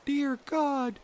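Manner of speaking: put-on very high, loud, slow girl's plea; girl crying. A narrator's reading. Grethel praying for help, very high slow girl's cry. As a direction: plead to God like a frightened girl. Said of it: "she cried".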